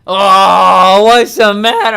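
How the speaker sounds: loud, hammed-up baby talk; loud, silly mock sympathy while hugging his girlfriend; goofy comforting. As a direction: coo at her like a goofy teddy bear.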